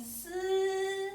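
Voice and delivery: "Si" held long on a high, level pitch; first tone on Si. Shown as a demonstration. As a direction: say "Si" high and flat, drawn out. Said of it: "if it's flat".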